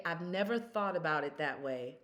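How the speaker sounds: normal talking voice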